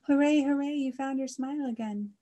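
high, jubilant hooray; cheering. A narrator's reading. Friends celebrate Mister Cow's smile, cheering high and loud. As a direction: burst into a loud cheer, then ease off. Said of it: "His friends let out a cheer".

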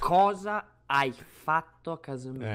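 slow, drawn-out scolding; sgridata scandita. Real, not acted. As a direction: stretch out each word like a reprimand. (domanda lenta e scandita, da rimprovero)

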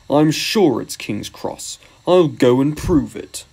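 slow, stubborn engine grumble; grumbling. A narrator's reading. Gordon rolling away from the argument, grumbling. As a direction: drag the words out stubbornly. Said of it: "still grumbling".

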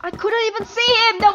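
loud, high real protest; frustrated outcry. Real, not acted. Gamer killed by someone they couldn't see, frustrated outcry. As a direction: cry out at an unfair death. Killed by unseen enemy in game.

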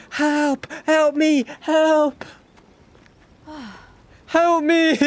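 high, loud cries for help; yelling for help. Real, not acted. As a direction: call out desperately. Calling for help in the game.

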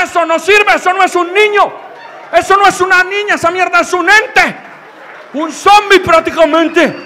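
loud, high mock-outraged rant; high-pitched shouted tirade, hammering every phrase. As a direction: yell it in comic indignation. Repeats the line as outraged rant.

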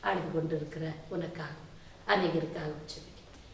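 very soft, low prayerful voice; hushed prayer. Real, not acted. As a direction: begin praying low and quietly for the one who weeps. Hushed opening of prayer after hymn. (மிக மென்மையான, தாழ்ந்த ஜெபக் குரல்)

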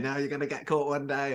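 lightly voiced finger-wagging old lady; a hint of a disapproving neighbour telling him off; scolding old woman. Interviewer imitating old women scolding the villain, lightly voiced. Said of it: "old women in the street come wagging their fingers".

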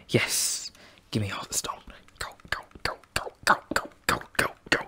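urgent whisper. Real, not acted. Whispered urging while mining.

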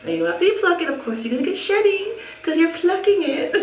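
ordinary talk, nothing marked

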